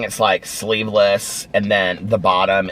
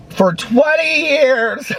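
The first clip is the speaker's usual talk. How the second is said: high, slow, exaggerated teasing; playfully throwing it back at her friend, high and slow; teasing drawn out; much slower than usual. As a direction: stretch it out to rub it in. Teasing friend about long favours.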